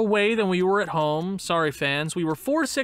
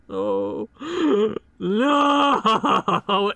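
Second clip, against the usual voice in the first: despairing no; 2 semitones higher than usual. Real, not acted. Star player injured.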